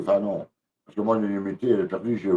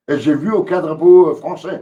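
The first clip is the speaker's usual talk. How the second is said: angry outburst; 6 semitones higher, 9 dB louder than usual. Real, not acted. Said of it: "Désolé pour mon coup de gueule".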